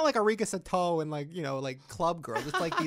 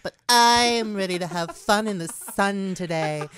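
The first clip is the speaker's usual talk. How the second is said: bubbly vlog voiceover parody, louder and pitched up; mock a YouTuber greeting her viewers; hammed-up influencer intro, drawn out and loud; Gigi voiceover impression; 3 semitones higher, 7 dB louder than usual. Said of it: "You do voiceover videos".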